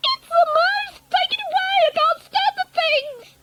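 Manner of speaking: very high, hysterical shriek; shrieking yell. A narrator's reading. Wife spots a mouse on the table, panicked shriek. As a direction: yell in panic at the top of your range.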